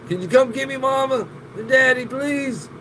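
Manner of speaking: high, pleading little alien call home. Retold or imitated. E T begging his parents on the phone, high plea.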